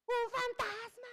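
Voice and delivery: high, slow frightened cry of a ghost; hammed-up terrified disciples; scared shriek. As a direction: shriek it like you have seen a ghost. Disciples panic seeing a figure.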